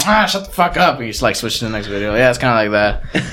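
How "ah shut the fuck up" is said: mock annoyance. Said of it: "you're like".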